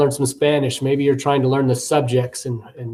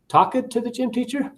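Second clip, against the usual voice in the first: "Talked to the gym teacher?" much higher, rising and puzzled; confused repetition; 10 semitones higher, 4 dB quieter than usual. Shown as a demonstration. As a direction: echo the mispronounced "Talked" back as a confused question. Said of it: "you can even do with pronunciation".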